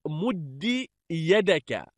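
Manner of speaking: مد ending in short "i", "muddi"; kasra reading. Shown as a demonstration. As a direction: say مد يدك slowly as "mud-di yadaka". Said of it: "جعله بمنزلة".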